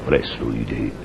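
low, soft ghostly voice; spirit voice. Acted. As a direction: speak as the spirit from beyond. Professor voices the spirit's answer. (voce cavernosa dello spirito)

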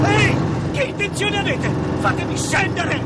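loud, frightened protests; handcuffed prisoner terrified of the crash; panicked yelling. Acted. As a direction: scream at the driver to stop. Prisoner in car as it rams.

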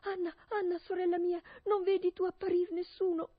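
high, loud anxious call. Acted. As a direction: cry out again to the sister. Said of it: "e da capo a gridare".

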